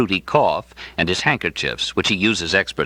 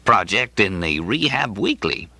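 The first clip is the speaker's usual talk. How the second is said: higher, genial voice of the coughing clinic director; amiable director; 6 semitones higher than usual. Acted. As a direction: play the bulging-eyed director, kindly and a bit vague.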